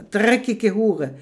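slow loud spat insult; furious insult. Acted. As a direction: spit out each word in disgust. Insult yelled.

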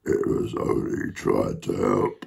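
very low, slow dog; deep, drawn-out doggy voice. Acted. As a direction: drop right down into a dog voice. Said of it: "said the dog".

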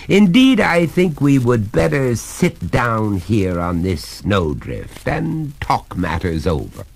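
mildly gallant, unhurried refusal; courteous. A narrator's reading. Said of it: "returned the master courteously".

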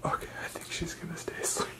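quick soft whisper; whispering over a crib. Real, not acted. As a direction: whisper so she stays asleep. Dog asleep.

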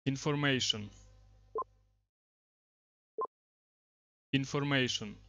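projecting a command at the microphone; loud voice command. Real, not acted. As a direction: call it out crisply so the software picks it up. Commands spoken to speech recognition.

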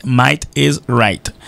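Might is right, each word spaced out slowly; slow and deliberate. Shown as a demonstration. Teacher on the radio slowing down so students hear "Might is right", slow. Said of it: "teacher models the proverb slowly".

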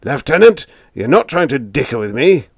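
loud, high indignant shout; outraged shout. A narrator's reading. Captain shouting at Biggs for bargaining, loud indignant shout. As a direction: blast it at him in disbelief. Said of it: "Hansen's voice lifted the roof an inch".

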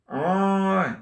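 ordinary talk

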